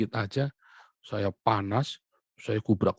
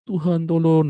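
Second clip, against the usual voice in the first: loud pleading cry, higher; crying prayer; 6 semitones higher, 9 dB louder than usual. Retold or imitated. Preacher reenacts his frightened, pleading prayer, loudly. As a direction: cry out the plea to God. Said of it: "nangis-nangis saya".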